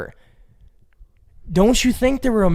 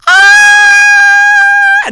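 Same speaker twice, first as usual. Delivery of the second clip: high loud hammed-up shout; mock sex shout; 14 dB louder than usual. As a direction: yell out in mock ecstasy. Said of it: "you wanna hear me having sex".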